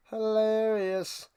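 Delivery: slow, stretched "Hilarious"; deadpan drawn-out "Hilarious". Acted. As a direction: savour the word "Hilarious" slowly. Repeats word drawn out.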